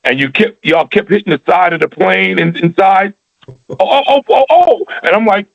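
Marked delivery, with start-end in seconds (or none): 3.5-4.8 mock fright. Scared on the plane, retold.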